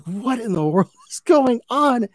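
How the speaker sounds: high, laughing disbelief; laughing at the absurd movie scene in disbelief. Retold or imitated. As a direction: ask it laughing, amazed by the absurdity. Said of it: "And I was like".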